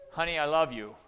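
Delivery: an angry yell of "Honey, I love you"; angry shout. Shown as a demonstration. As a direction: snap the loving words like a threat. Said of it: "Or I could say".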